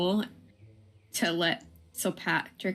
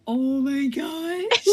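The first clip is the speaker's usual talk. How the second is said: higher, louder and very slow, drawn out in awe; amazed gasp; 7 semitones higher, 4 dB louder, much slower than usual. Real, not acted. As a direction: let the awe stretch every word. Dog buried in deep snow.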